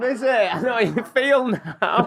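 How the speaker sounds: high, slow mimicry of a mother; man doing a woman's voice. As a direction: camp falsetto mum impression. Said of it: "Do you wanna do your impression of my mother?".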